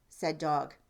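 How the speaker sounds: plain reading voice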